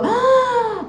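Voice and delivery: high mock gasp of the crowd; shocked gasp. Audience gasps in shock. (suspiro chocado da plateia)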